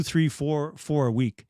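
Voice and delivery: normal talking voice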